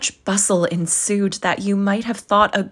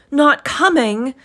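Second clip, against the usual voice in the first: higher, louder, slow dismayed echo; crestfallen father; 9 semitones higher, 6 dB louder than usual. Acted. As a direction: let his spirits sink in disbelief. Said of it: "with a sudden declension in his high spirits".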